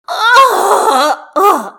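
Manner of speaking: a loud, high, exaggerated groan; theatrical child groan. A narrator's reading. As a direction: throw back your head and groan like a bored child. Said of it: "throws back her head and groans".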